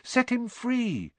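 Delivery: high, drawn-out plea; urging. A narrator's reading. Harry pressing Hagrid to release the dragon, urging. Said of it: "Harry urged".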